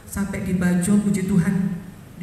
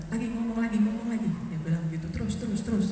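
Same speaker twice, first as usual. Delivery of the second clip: softer, weak voice begging to keep going; soft urging; 2 semitones higher, 6 dB quieter, faster than usual. Retold or imitated. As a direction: plead quietly from a sickbed. Weak patient urging softly. (bisikan lemah mendesak terus)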